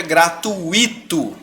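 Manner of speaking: "gratuito" with I split from U, gra-tu-I-to; wrong hiatus pronunciation. Shown as a demonstration. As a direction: stress a separate I in "gratuito". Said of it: "senão a pronúncia fica".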